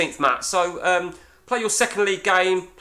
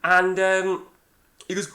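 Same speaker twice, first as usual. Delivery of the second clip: ordinary talk, nothing marked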